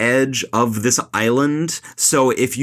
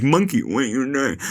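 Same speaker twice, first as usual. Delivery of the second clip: lightly voiced deaf father, words slurred together; imitating deaf dad. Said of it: "he's just like".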